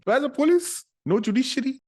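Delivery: very high, loud incredulous questions; high-pitched, hammed-up disbelief; exasperated outcry. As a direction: squawk in mock outrage. His exasperated reaction to the plot.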